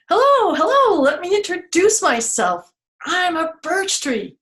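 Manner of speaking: high, loud cheery tree character; squeaky storybook tree saying hello; birch tree voice. Acted. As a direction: greet them brightly as a talking tree. Tree character introduces itself.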